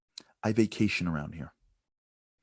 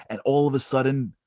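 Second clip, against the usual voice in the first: usual speaking voice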